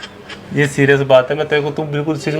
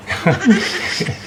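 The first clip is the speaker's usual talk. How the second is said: stifled laugh; 9 semitones higher than usual. Real, not acted. Laughs mid confession.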